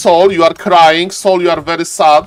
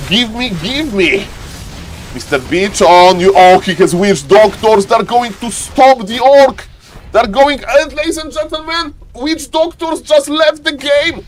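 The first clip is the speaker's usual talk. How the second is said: excited play-by-play; 7 semitones higher than usual. Acted. Caster hyping viewers over a Warcraft match, excited play-by-play. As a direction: call the action like a thrilled caster.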